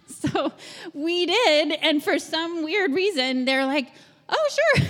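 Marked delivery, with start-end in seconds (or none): 4.3-4.9 cheery agreement. Retold or imitated. Couple agrees to hosting.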